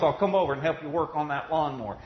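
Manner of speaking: loud, high preaching voice, building; rising sermon fervor; fervent preaching. Acted. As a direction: preach it out with fire. Preaching at full force.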